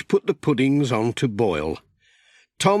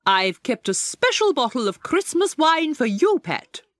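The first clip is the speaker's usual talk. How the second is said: much higher, prim lady's voice; Miss Hubbard; 13 semitones higher than usual. A narrator's reading. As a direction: offer it high and pleased, a fussy lady.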